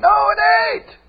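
high cry of no; emphatic rebuttal. Acted. Preacher rebutting churchgoers with a high cry. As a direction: snap back high.

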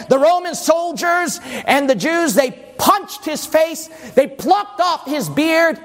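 punchy pulpit storytelling rising in pitch; forceful preaching. Acted. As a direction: preach the violence with mounting intensity.

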